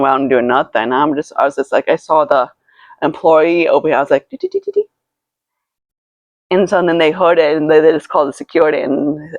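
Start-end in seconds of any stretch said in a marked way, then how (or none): 4.3-4.9 squeaky repeated dee syllables; high-pitched dee-dee noise. Retold or imitated. Signalling a store employee in a story, very high repeated squeak. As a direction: imitate a little noise way up high.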